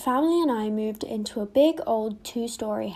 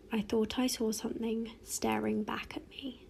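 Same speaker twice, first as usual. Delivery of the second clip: hushed spooky whisper; 7 dB quieter than usual. Acted. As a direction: breathe it out in fear. Ghost story climax.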